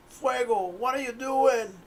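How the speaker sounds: high, alarmed question; startled magician. Acted. Magician shocked at what his fire gnome is doing, high and alarmed. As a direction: gasp it out high in disbelief. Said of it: "the magician just is like".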